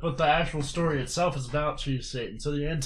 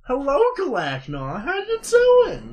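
put-on high, slow old lady voice; man doing a woman's voice; 9 semitones higher, 5 dB louder than usual. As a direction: sing it up high like a doting mom. Mom character greeting son.

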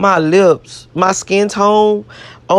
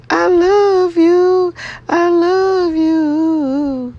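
very high, very slow, drawn-out I love you; sing-song I love you to her own picture; cooing to herself; 12 semitones higher, much slower than usual. Pretend. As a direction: coo it up high like a lover.

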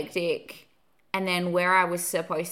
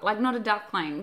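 ordinary delivery

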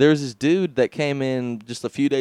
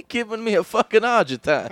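mock Italian accent; 9 semitones higher than usual. Continues Italian bit.

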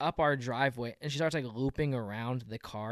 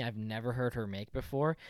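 ordinary delivery

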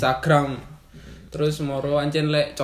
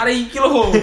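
higher, laughing complaint; 9 semitones higher, 4 dB louder than usual. Real, not acted. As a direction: laugh it out at him. Laughing at friend.

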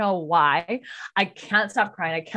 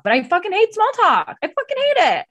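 much higher and louder, exasperated; pitched-up, emphatic rant about small talk; heated outburst; 13 semitones higher, 6 dB louder, faster than usual. Real, not acted. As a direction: blurt it out like you've had enough. Venting about hating small talk.

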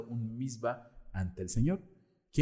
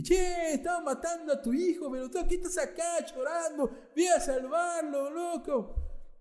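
high, loud Argentine Satan taunting; Argentine accent; 3 dB louder than usual. Acted. Satan urging Sara in an Argentine accent. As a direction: yell at her like a brash Argentine. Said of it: "voz de argentino".

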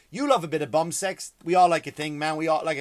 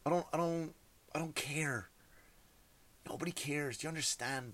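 quiet; 10 dB quieter than usual. Real, not acted. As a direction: pull it way down, almost hushed. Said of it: "drops voice after rant".

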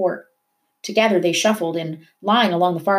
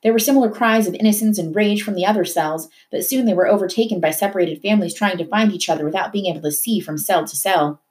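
ordinary delivery

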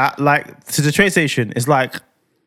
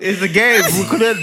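laughing; 4 semitones higher than usual. Real, not acted. Laughing about the ride.

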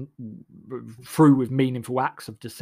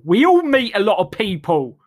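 loud, high indignant retort; 8 semitones higher, 8 dB louder than usual. Acted. Answering people who forget meeting him, loud and high. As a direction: fire it back.

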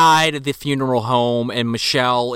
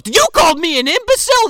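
mock outraged; 4 dB louder than usual. Cab driver taking offense.

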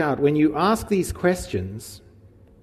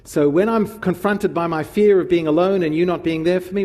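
ordinary talk

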